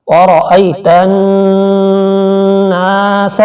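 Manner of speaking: slow chanted tajweed recitation; Quran recitation. Shown as a demonstration. Teacher demonstrating recitation to students, slow and chanted. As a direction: draw out each syllable in melodic recitation. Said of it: "Urdu تلفظ".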